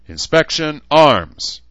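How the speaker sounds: loud, high-pitched military order; drill command. Acted. Mayor ordering the recruit to present his sword, loud drill command. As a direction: bark it with the last word hit hard.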